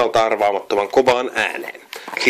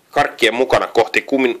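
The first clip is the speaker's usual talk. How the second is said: ordinary delivery, nothing marked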